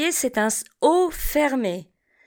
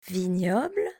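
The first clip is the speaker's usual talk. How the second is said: Vignoble repeated softer, lower, rising; 4 semitones lower, 6 dB quieter than usual. Shown as a demonstration. Teacher echoes Vignoble quietly for the class. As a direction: softly echo Vignoble. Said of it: "Répétez après moi".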